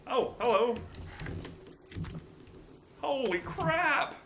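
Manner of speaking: high, loud burst of alarm; startled exclamation. Real, not acted. Player runs into a crowd of cannibals, startled. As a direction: jump up in pitch, caught off guard. Suddenly meets crowd of enemies.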